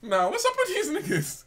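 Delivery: very high, laughing squeal of a question; laughing protest. Real, not acted. Storyteller questioning his watching friends, high and laughing. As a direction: protest while cracking up.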